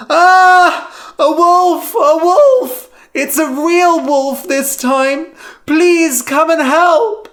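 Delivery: high, very loud terrified scream; screaming for help. A narrator's reading. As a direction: scream for help in real panic. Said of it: "Lyman screamed".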